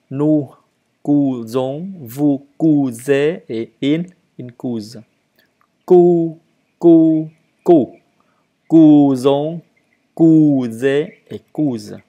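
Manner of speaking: deliberate teacher's drill with separated syllables; emphatic, spaced-out verb drill; model conjugation. Shown as a demonstration. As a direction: draw out each form for learners to copy. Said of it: "à lire et à le dire".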